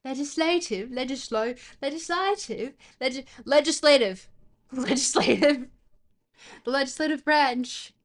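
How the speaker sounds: punchy mock-British accent practice. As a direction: try the word out in hammed-up British. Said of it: "does the British accent come from the face".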